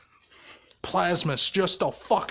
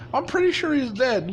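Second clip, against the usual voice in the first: fake nonchalance; 8 semitones higher, faster than usual. Izuku acting like he's dead.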